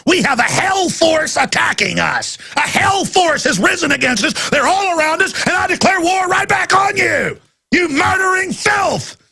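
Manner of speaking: high, full-throated battle cry; furious shouted rant. Real, not acted. Host declares war on enemies, furious shouting.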